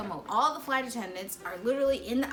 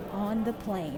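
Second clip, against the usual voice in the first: normal talking voice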